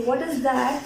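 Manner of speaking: ordinary delivery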